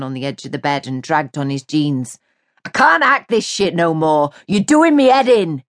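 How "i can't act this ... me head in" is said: rising, exasperated lad's voice; sick of it all, let it out; fed-up outburst. A narrator's reading. Jay telling Mary he's had enough, exasperated.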